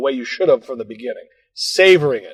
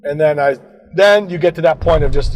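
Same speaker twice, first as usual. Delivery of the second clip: normal talking voice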